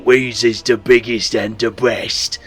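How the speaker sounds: slow, loud Cockney ork boast; orc voice. Shown as a demonstration. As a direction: brag like the biggest ork boy. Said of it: "That's the orc voice".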